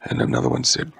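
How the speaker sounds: ordinary delivery, nothing marked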